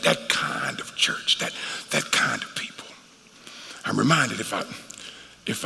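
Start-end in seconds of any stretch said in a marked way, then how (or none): 0.0-2.9 soft, low whisper trailing off. Real, not acted. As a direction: let it fall to a whisper. Preacher trails into hushed whisper.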